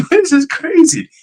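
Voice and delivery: very high-pitched astonished reaction; amazed disbelief. Retold or imitated. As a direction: squeal it in mock amazement.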